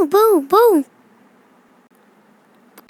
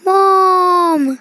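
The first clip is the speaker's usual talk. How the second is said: drawn-out, slow call for mom; 2 semitones higher, much slower than usual. Acted. Boy scared of the turtle calling his mom, long slow cry. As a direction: stretch it out, calling for help across the yard. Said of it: "ये रोहित क्यों चिल्ला रहा है".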